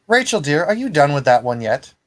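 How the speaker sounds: high, sugary call to her daughter; sweet high spider lady. Acted. Spider mother calling sweetly to Rachel in the alley. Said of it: "And she says".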